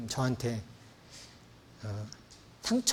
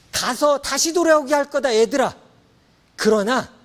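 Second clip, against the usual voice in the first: high, loud call as God; 11 semitones higher, 8 dB louder than usual. Acted. God calling his scattered people home, loud and high.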